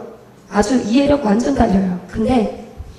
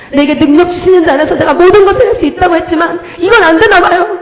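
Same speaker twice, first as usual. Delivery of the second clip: 울먹이는 기도 흉내; 6 semitones higher, 5 dB louder than usual. Retold or imitated. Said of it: "막 이러면서 제가 막 울면서".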